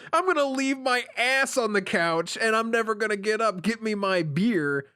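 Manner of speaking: high, hammed-up lazy boor demanding beer; mock lazy slob. Mocking a lazy partner's reply to a complaint, hammed-up slob voice. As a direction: sneer it out like a lazy husband on the couch.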